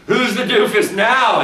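a hint of a gloating kid, laughing; taunting boy. Boy taunting his dead brother's skull, mild mock gloat. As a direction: tease it like a smug brother. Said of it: "he asks".

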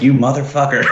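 mock-shocked friend cracking up; laughing mock outrage. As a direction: swear it out loud while laughing. Friend's stunned reaction to bad news.

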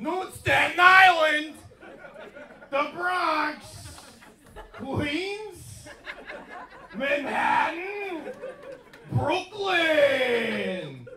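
high-pitched hollered roll call; shouting the boroughs. Acted. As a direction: call out each place like a crowd rallying cry. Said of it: "You just shout the boroughs".

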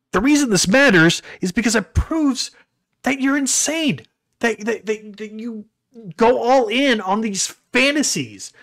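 high-pitched, worked-up telling-off; retold exasperated rebuke. Acting out his angry rebuke.

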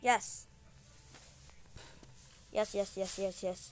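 excited cheer. Real, not acted. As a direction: cheer the win. Winning the round.

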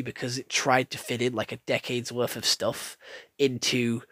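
normal talking voice

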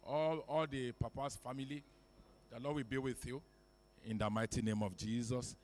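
ordinary talk

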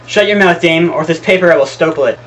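loud, high furious order; angry shout. Acted. Husband silences his wife with an incriminating paper, angry shout. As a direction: shout them down.

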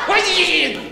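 sudden high, wired yell; cocaine yell. Acted. Comic demonstrating a coked-up party shout, high and sudden. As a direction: bark it out like you're high on coke. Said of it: "Ese sonó un grito de coca".

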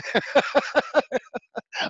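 high wheezing laugh; long high-pitched laughing fit. Real, not acted. Laughing at his own photo admission, high laugh.

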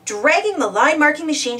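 ordinary delivery

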